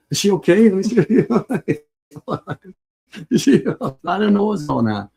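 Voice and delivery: high, loud, laughing 'is she okay'; mock his own baffled worry through laughter; laughing disbelief. Father recalling baby who never cried, laughing and high. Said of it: "I was like".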